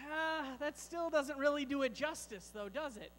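ordinary delivery

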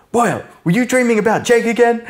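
high, fast teasing voice; mock Terry. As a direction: tease him in a high quick voice. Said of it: "And he's like".

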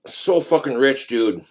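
talking between bites; chewing while speaking; mouth full, chewing. Real, not acted. Seen 3: Bit pizza at -5; mouth works while talking, pizza in hand.

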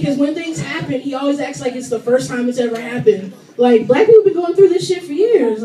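ordinary talk

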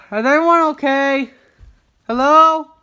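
calling out. Pretend. Calls to the other toys after a crash, calling out loudly. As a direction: shout to check on everyone.

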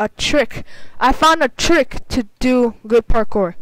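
normal talking voice

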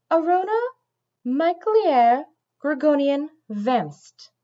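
slow, deliberate recitation of a name; recited slowly. A narrator's reading. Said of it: "Morwen recited".

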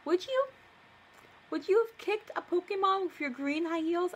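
high, incredulous, sarcastic; sarcastic high-pitched question; mocking disbelief. Real, not acted. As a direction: ask it mockingly. Mocking question to her story.

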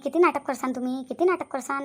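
ordinary talk

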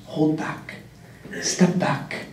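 soft unvoiced whisper to himself; whispered self-reminder. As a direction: hush it as if coaching yourself under your breath. Telling himself to hold back.

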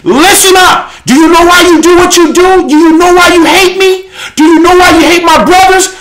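impassioned pulpit call-out; fiery preaching. Real, not acted. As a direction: preach it hard, demanding they listen up. Preaches at full force.

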